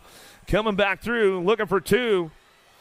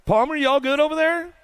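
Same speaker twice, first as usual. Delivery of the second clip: calling out; 7 semitones higher than usual. Real, not acted. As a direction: shout over to check on her. Calling to fallen rider.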